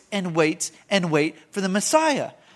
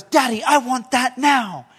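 put-on slow, whiny demand of a spoiled girl; spoiled Veruca Salt; 2 semitones higher than usual. Preacher imitating a spoiled girl demanding from her father, slow whiny voice. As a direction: drag it out like a bratty child wanting it now. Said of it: "she goes".

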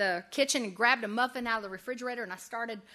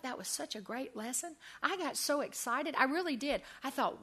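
normal talking voice

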